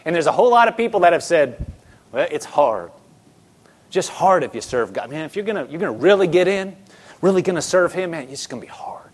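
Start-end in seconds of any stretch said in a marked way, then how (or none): 2.1-9.1 low, downbeat grumble, fading softer; discouraged complainer. Retold or imitated. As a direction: mimic someone resigned to hardship. Voicing people who complain it's hard.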